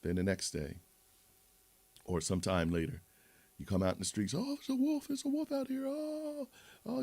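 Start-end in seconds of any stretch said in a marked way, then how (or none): 4.3-7.0 put-on high, panicky falsetto boy; much higher mock cry for help; boy crying wolf. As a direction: shriek it up high like a scared kid.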